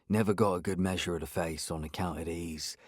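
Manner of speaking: put-on soft, low old local voice; lightly voiced old man, mumbling softly; Benny's voice. A narrator's reading. Benny explains his eyesight.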